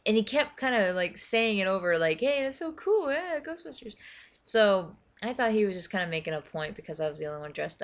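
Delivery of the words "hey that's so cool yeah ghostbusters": high-pitched put-on enthusiasm; imitated enthusiastic boss. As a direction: gush like an impressed boss. Same guy repeating his excitement.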